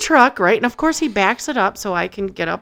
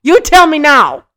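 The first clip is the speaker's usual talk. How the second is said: demanding; 8 semitones higher, 10 dB louder than usual. Retold or imitated. Demanding bad news.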